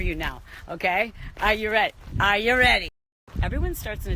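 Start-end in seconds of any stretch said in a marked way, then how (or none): 1.4-1.9 asking her viewers loudly, falling at the end; hype question. Real, not acted. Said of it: "hyping up viewers". 2.2-2.9 louder again, stress on ready; bigger hype. Real, not acted. Vlogger hyping viewers before wrap-up: louder, punchier repeat. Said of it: "hyping up viewers".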